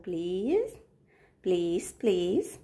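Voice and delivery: slow, hushed please, please; soft pleading. Real, not acted. Mother begging crying Ruby to stop, softly and slowly. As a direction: beg tenderly, barely above a murmur.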